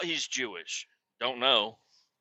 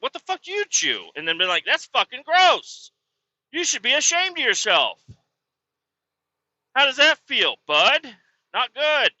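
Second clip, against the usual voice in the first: imagined angry retort; 8 semitones higher than usual. Pretend. What he should have told the guy, high angry scolding. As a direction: scold him like he should be ashamed.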